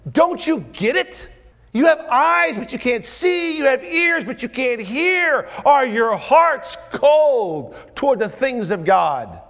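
very high, loud, slow; full-force preaching plea; impassioned rebuke. Acted. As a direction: cry it out to them.

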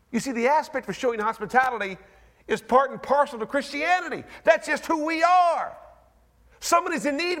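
rousing pulpit preaching; preaching at full force. Acted. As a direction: preach it with fire to the congregation. Preaching on hospitality.

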